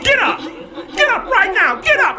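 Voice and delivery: panicked high shouting; a wild-eyed father yelling in the dark; frantic shout. Retold or imitated. Father waking his kids in panic, shouting high. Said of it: "he's shouting crazy".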